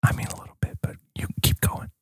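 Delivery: very soft whisper; hushed, breath-only reply; ASMR whisper. Pretend. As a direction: whisper back as a drowsy listener. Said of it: "It's A S M R".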